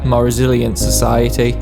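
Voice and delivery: usual speaking voice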